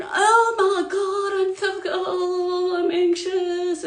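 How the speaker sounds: hammed-up whine of an anxious human; mock anxious human. Mocking humans, voicing their anxious thoughts as a sing-song wail. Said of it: "we're over here thinking".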